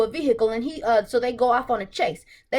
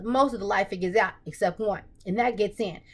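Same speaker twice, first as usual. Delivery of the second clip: ordinary talk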